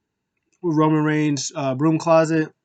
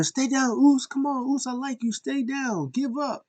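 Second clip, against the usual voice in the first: retold yelling; 10 semitones higher than usual. Wrestler taunting his fallen rival, high mock yell. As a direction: imitate him shouting down at a beaten opponent. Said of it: "he was yelling".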